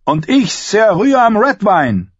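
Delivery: high, loud, slow proclamation; boastful call. A narrator's reading. John answering Robb in their game, calling out. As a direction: proclaim your knightly name across the yard. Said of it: "worauf John erwiderte".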